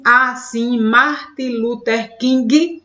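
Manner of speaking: slow, stretched reading of the line; slow verse reading. Shown as a demonstration. Teacher reads the second verse aloud, counting seven syllables slowly. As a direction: let every syllable count out. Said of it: "chegou nas sete".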